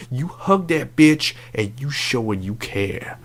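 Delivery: ordinary delivery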